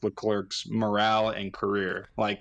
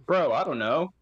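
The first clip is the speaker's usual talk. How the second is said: mock team radio; 7 semitones higher than usual. Acting team radio with driver.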